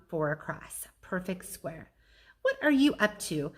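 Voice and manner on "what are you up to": high, loud demanding dog voice; Bob demanding. Acted. Bob demanding to know Ivan's plan, high and loud. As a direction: demand it nosily. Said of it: "Bob demands".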